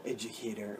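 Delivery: imitating a hushed cool-guy educator; quiet educator voice. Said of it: "you do this, like, quiet voice".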